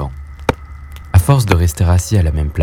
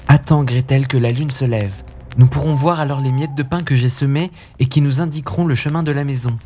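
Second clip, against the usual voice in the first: put-on lightly raised boy's voice, tender; a brother soothing his little sister; gentle boy Hansel; 6 semitones higher than usual. Big brother gently comforting his scared sister.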